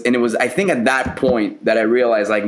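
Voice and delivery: ordinary talk, nothing marked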